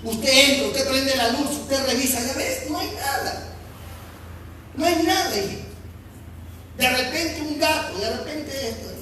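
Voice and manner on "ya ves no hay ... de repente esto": soothing parent. Retold or imitated. Parent reassuring child in room.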